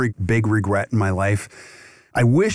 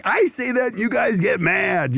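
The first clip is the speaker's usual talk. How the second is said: mock complaint; 9 semitones higher than usual. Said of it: "I'm like".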